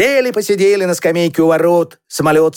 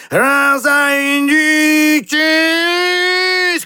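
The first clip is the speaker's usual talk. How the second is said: very long, high holler climbing upward; high, hugely drawn-out warning cry; boy shouting; 8 semitones higher, much slower than usual. A narrator's reading. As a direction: stretch the word into a rising siren-like yell. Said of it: "крикнул Петька".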